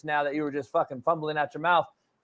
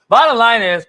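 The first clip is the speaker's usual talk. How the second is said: hammered emphasis; 3 semitones higher, 8 dB louder than usual. Real, not acted.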